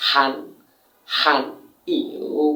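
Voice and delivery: "হান" falling, "ইউ" low dipping; tone demonstration. Shown as a demonstration. Teacher shows learners Chinese pronunciation, toned demonstration. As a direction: say "হান" and "ইউ" apart, each with its tone. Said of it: "আমি tone সহকারে উচ্চারণ দিচ্ছি".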